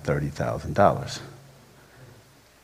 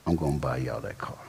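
whispered, much softer; mother-in-law whispering her promise; 4 semitones lower, 7 dB quieter, faster than usual. Retold or imitated.

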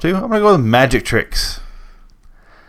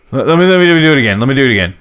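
higher, louder, stammered plea; mock flustered magician; 3 semitones higher, 4 dB louder, much faster than usual. Magician to friend after wrong card, flustered pleading. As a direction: imitate a botching magician begging for a retry.

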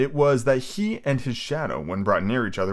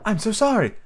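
put-on high girl voice; 11 semitones higher than usual. Wendy apologizes.

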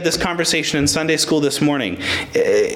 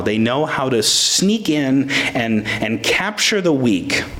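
ordinary talk, nothing marked

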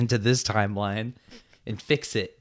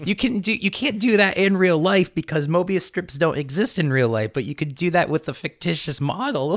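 heated arguing; 9 semitones higher than usual. Real, not acted. Heated Mobius argument.

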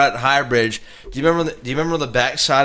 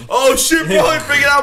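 much higher, slightly louder mock panic of a scared friend; panicky friend; 12 semitones higher, 4 dB louder than usual. Teller imitating little Matt panicking under the bridge. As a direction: imitate a buddy losing it, high and hammed-up. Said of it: "and you know how he is".

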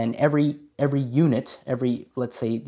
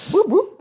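siren imitation; 8 dB louder than usual. Siren noise echoing the jingle.

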